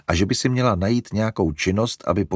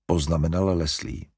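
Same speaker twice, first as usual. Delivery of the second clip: plain reading voice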